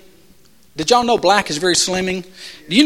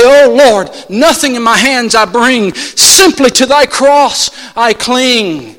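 hymn prayer; 4 semitones higher, 5 dB louder, slower than usual. Acted.

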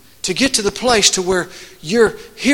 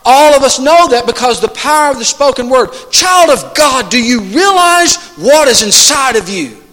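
higher, driving pulpit delivery; emphatic preacher, punching key words; fervent preaching; 6 semitones higher, 6 dB louder than usual. Real, not acted. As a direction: exhort the congregation with rising conviction. Preaches at full force.

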